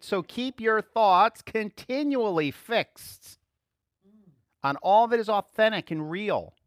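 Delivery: slow, loud, weighty reading of the verse; solemn Bible reading; scripture reading. Acted. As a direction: read it out to the class, slowly and with weight.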